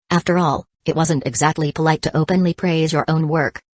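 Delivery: ordinary delivery, nothing marked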